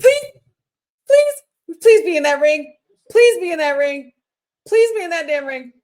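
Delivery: high, loud begging; desperate pleading. Real, not acted. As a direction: beg out loud in hope. Fan begging for a return.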